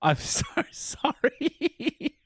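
slow, high apology through laughter; laughing apology. Real, not acted. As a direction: say sorry while cracking up.

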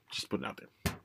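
soft whisper, tossed off; whispered aside. Real, not acted. Reviewer whispers a quick aside to viewers. Aside after explaining Fubar.

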